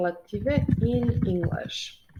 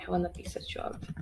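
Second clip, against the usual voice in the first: whisper to herself; 9 dB quieter, much faster than usual. Real, not acted. Whispers while writing on the board.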